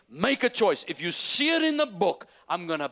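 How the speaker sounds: high, loud preacher's cry; full-force preaching. Acted. Pastor challenging his church to choose belief, high and loud. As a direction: shout the challenge out.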